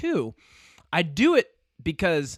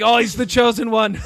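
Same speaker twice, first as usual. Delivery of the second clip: hammed-up awe, louder; awed mock reverence; 4 semitones higher, 4 dB louder than usual. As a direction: announce him like a prophecy. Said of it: "he's like".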